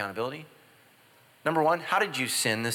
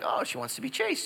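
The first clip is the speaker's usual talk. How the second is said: hopeful inner thought; 10 semitones higher, 5 dB quieter than usual. Retold or imitated. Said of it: "I'm like".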